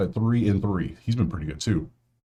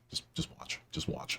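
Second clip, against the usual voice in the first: whisper; 8 semitones lower, 17 dB quieter than usual. Real, not acted. Ominous prediction about strikeouts.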